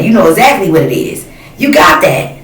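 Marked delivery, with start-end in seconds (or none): 1.6-2.3 "You got that" jumps much higher, hammering it home; emphatic high repeat. Real, not acted. Telling empresses they have it, pitched way up.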